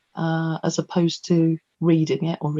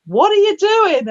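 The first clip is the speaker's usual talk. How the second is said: high-pitched mock scolding, incredulous; telling off; 12 semitones higher, 4 dB louder than usual. Workshop leader telling off a poet, high scolding. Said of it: "really told her off".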